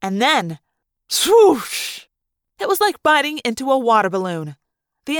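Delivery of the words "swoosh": high rushing whoosh; swoosh. A narrator's reading. Narrator voicing the water blast with a whoosh. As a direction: blow it out like a burst.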